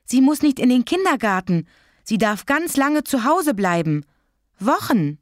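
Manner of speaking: put-on high-pitched child protesting in envy; envious. A narrator's reading. Child envying Sarah's time at home, indignant and envious. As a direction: make her good luck sound outrageous. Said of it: "Der blanke Neid stand ihr in den Augen".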